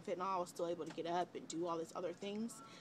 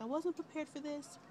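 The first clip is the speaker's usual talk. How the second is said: tearful; 8 semitones higher than usual. Retold or imitated. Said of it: "I just am bawling".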